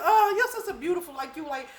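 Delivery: high, loud mock upset, breaking into laughter; hammed-up indignation with the pitch pushed up; retold upset outburst. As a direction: imitate his worked-up protest, half laughing. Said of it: "He was really upset".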